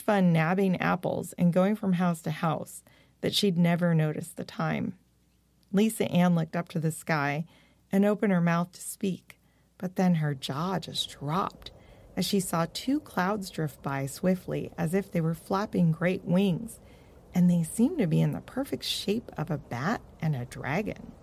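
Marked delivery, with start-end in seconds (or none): none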